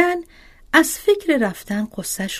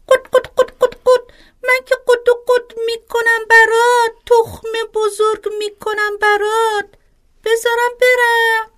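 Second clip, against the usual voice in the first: much higher, louder clucking hen voice; 14 semitones higher, 6 dB louder than usual. Acted. Hen speaks in a fable.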